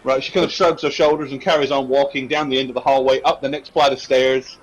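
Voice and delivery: ordinary talk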